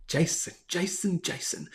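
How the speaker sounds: slow, very soft repeated name; hushed mock reproach. Host to chat regular Jason, hushed mock reproach. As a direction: breathe the name in mock disappointment.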